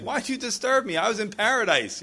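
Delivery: high-pitched mock complaint; playfully put-out, pitch jumping up; upset. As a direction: imitate an indignant, upset man roused from rest. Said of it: "man, he's upset".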